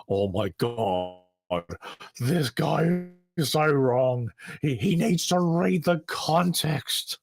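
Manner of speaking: slow, drawn-out mock exasperation climbing high; indignant apologist. Critic imitates an apologist objecting in their head, mock exasperated. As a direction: put on a whiny-slow objector, stretching every word. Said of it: "probably be saying in your head".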